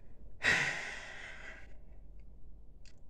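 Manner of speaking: a long, breathy sigh out. Real, not acted. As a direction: let all the air out slowly. Long sigh while he thinks.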